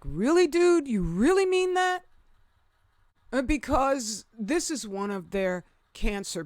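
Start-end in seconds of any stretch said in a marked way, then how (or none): 0.0-2.0 high disbelieving retort; incredulous. Retold or imitated. Reacting to the doctor on screen, incredulous. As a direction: react as if he can't be serious. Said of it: "that I was like".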